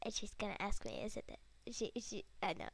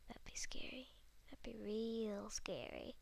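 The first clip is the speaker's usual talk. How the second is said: whispering; 2 semitones lower, 8 dB quieter than usual. Real, not acted. Whispered aside.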